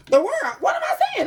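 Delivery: high, laughing squeal of surprise; excited shriek. Real, not acted. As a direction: shout it out, thrilled and laughing. Shocked by drawing the World card.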